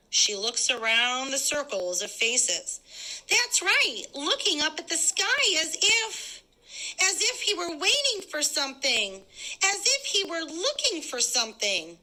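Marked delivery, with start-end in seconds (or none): 3.3-12.0 higher woman's voice, telling a secret suspicion; suspicious woman. Acted. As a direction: raise the voice to a nosy neighbor woman. Woman insists to crowd.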